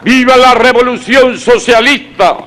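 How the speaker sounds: high rousing rally shout; ranting dictator speech. Real, not acted. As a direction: shout the slogan to the crowd. Consignas gritadas al final. (consigna gritada con fervor)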